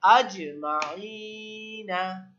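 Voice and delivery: আজমাইনা drawn out slowly, the জ made heavy with a bounce; thick qalqalah. Shown as a demonstration. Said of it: "আমাদের বলতে হবে মোটা করে". (আজমাইনা মোটা করে, কলকলা দিয়ে ধীরে)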